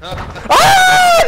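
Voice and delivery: very high, loud jump-scare shriek; startled scream. Real, not acted. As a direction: scream as if something jumped out.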